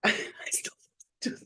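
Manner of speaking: breathy whispered aside. Real, not acted. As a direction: whisper it to yourself, rueful. Whispered aside after a joke.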